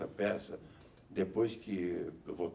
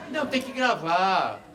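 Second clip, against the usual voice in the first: insistent call; 11 semitones higher, 9 dB louder than usual. Real, not acted. Insisting on recording.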